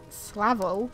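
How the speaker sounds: ordinary delivery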